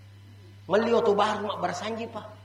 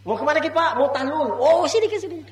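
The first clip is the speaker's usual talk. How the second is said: louder, higher eager tout calling out; 6 semitones higher, 5 dB louder than usual. Retold or imitated. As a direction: call him over like a pushy guide. Tout calling pilgrim, retold. (calo yang memanggil jamaah, suara lebih keras dan tinggi)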